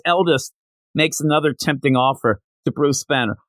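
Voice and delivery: ordinary talk, nothing marked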